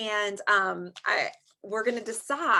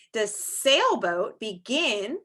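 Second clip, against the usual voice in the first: usual speaking voice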